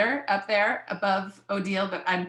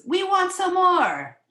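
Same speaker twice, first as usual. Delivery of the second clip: high, loud, drawn-out demand; slow, excited storybook cheer; children's shout; 12 semitones higher, 7 dB louder, much slower than usual. Acted. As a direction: chant it like eager children. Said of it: "they shout".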